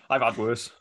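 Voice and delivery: laughing through the words; giggling while speaking. Real, not acted. Laughing at name mix-up.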